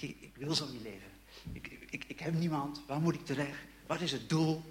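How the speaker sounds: despairing murmur. Retold or imitated. Teenager tells God he has no one, hushed despair. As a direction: let it drop to a near whisper.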